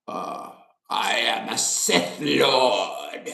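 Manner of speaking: low, slow Sith Lord voice; Emperor impression. Imitating the Star Wars Emperor to his viewers, low slow villain voice. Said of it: "he talk like this".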